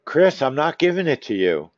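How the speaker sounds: usual speaking voice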